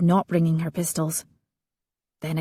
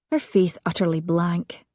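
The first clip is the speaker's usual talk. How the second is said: plain reading voice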